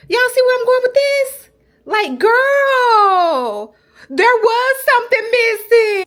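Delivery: high and loud; excited shout to the viewers. Real, not acted. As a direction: gush over the corner. Excited about room corner.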